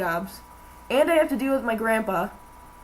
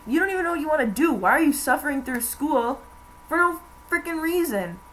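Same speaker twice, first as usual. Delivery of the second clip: higher, fast, worked-up mock lecture; retelling his pushy disbelief, pitched up; quoting an excited rant; 6 semitones higher than usual. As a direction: fire off his questions like he can't believe it. Guy's rant quoted in a story.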